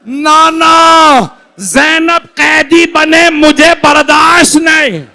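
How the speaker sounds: high, slow, loud wailing cry; anguished crying shout. Acted. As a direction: cry out to the grandfather in despair. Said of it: "मौला रोने लगे". (रोते हुए ऊँची, धीमी चीख़)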